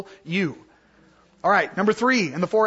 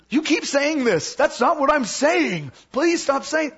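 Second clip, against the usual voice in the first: high-pitched exasperated complaint; acted mad protest; 6 semitones higher than usual. Teacher acts out someone getting mad at not being understood, exasperated. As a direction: play someone fed up at being misunderstood, pitch up. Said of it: "sometimes they're getting mad".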